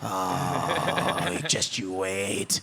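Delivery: low, scheming whisper; spiteful whisper. Retold or imitated. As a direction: whisper it like a gleeful threat.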